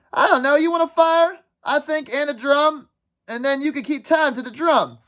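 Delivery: put-on high-pitched boyish voice; eager boy Maurice. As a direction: pitch your plan keenly to the gang.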